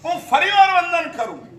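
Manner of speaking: high, loud, laughing offer; laughing eager devotee. Pretend. Devotee eagerly offers another bow, laughing high and loud.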